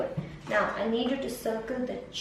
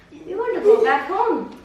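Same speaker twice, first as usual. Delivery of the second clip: emphatic; 6 semitones higher, 8 dB louder than usual. Real, not acted. Tutor stresses the goal to child.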